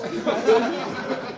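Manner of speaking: sudden loud laugh. Real, not acted. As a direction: laugh mid-thought. Laughs mid-sentence.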